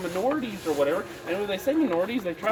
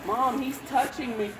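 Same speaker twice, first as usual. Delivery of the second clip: whiny tattling little sibling; 5 semitones higher, 4 dB quieter, slower than usual. Retold or imitated. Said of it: "Obama's just like".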